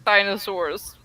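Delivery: normal talking voice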